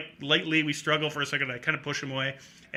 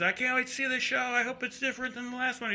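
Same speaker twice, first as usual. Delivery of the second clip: high-pitched imitation of an eager drunk; tipsy greeting pitched way up; drunk fan; 9 semitones higher, 5 dB quieter than usual. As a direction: put on an excitable drunk guy's voice. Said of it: "you know, drunk guy".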